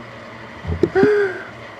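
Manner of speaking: sharp, high tearful gasp; a choking intake of air fighting tears; choked gasp. Real, not acted. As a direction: catch your breath like a sob rising. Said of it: "I'm not crying".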